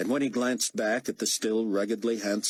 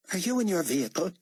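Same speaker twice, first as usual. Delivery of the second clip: pressing question, higher and quick; demanding; 10 semitones higher, faster than usual. A narrator's reading. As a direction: demand it impatiently. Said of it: "David demanded".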